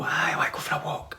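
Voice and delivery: whispered, unvoiced dog reply. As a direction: voice the dog in a tiny hush. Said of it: "And the dog will go".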